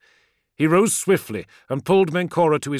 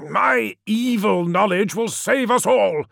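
growled; 9 semitones higher, 4 dB louder than usual. A narrator's reading. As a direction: growl the words out. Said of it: "Biaki growled".

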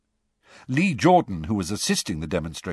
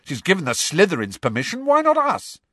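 indignant; 9 semitones higher than usual. A narrator's reading. Ron continues angrily.

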